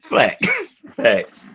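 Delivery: shouted affirmation, the first leaping high; loud emphatic agreement. Real, not acted. Agreeing loudly with a friend's rant about hookups, emphatic shout. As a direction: bark it out like a crowd agreeing.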